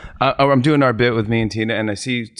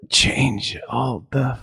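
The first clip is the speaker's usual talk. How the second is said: dazed Smigel voice; 4 dB quieter, much slower than usual. Retold or imitated. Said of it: "without missing a beat, he goes".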